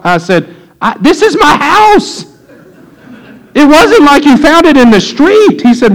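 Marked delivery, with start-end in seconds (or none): 0.8-5.6 much higher, half-laughing indignation; this is MY house, incredulous; indignant. Retold or imitated. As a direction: pastor to youth who took his money, incredulous and laughing. Pastor confronts youth over theft.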